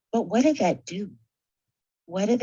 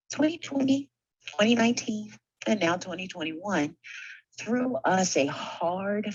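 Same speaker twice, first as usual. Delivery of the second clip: usual speaking voice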